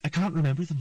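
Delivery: put-on young girl voice. A narrator's reading. Ciri answering.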